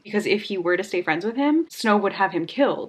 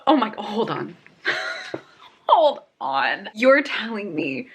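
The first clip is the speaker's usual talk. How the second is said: ordinary talk, nothing marked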